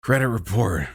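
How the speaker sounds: put-on sleepy old politician with halting phrases; mock presidential ramble, drowsy and halting; Sleepy Biden. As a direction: drift through it, pausing between phrases. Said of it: "as, uh, Sleepy Biden".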